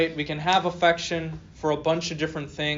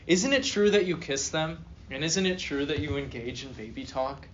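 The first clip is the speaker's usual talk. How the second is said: usual speaking voice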